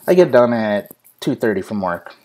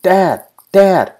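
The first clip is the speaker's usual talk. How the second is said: put-on high, louder child calling out; mock kid yelling; 9 semitones higher, 6 dB louder than usual. Dad imitating his son yelling for him, high kid call. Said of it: "he's yelling".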